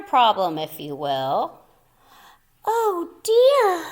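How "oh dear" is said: higher, dismayed motherly "oh dear"; mother's sigh. Acted. Mother sighs at the empty corner, dismayed and higher. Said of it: "sighed the missus".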